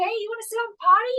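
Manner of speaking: put-on high, quick coaxing to a toddler; sing-song coaxing. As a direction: coax a little kid brightly.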